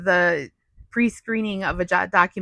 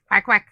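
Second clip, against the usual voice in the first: quacking like a duck; duck quacks; 6 dB louder than usual. Pretend. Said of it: "with her duck voice".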